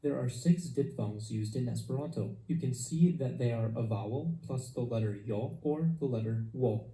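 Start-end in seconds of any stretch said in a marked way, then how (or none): none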